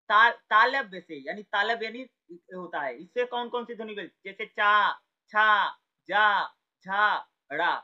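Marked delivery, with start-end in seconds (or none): 4.4-7.7 loud slow चा छा जा झा, each drawn out; palatal sounds demo. Shown as a demonstration. Teacher drills palatal consonants, loud and slow. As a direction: sound out चा छा जा झा one by one. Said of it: "ये तालव्य से बोलिए".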